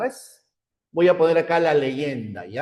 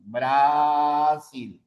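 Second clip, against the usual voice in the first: slow example word. Shown as a demonstration. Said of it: "Así".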